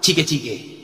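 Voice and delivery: a light imitation of Taiwanese; Taiwanese phrase. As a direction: say the foreign phrase in a mock tone. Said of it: "Bahasa Taiwan tuh tahu saya cuma satu".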